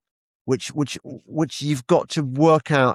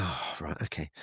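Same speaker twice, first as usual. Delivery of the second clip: much softer, low mock resignation; wife's resigned reply; 8 semitones lower, 15 dB quieter than usual. Husband quotes his wife giving in, very soft and low. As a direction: let it drop, soft and low. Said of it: "And she said".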